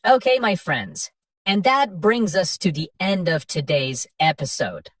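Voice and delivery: normal talking voice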